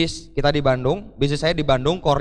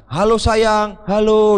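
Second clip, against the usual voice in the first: lovey phone hello; 5 semitones higher, 5 dB louder, slower than usual. Retold or imitated. Friends phoning girlfriends in field.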